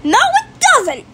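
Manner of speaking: high-pitched indignant protest; heated contradiction. Real, not acted. Child insisting Minecraft is good, indignant. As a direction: argue back in a high voice. Siblings quarrel over the game.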